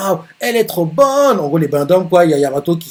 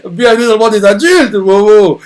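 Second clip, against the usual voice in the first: mocking tease; 6 semitones higher, 8 dB louder than usual. Character teases Momo, mocking.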